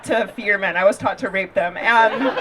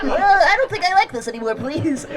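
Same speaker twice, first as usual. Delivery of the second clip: scared guy voice; 6 semitones higher than usual. Retold or imitated. Stand-up, mimicking the scared driver.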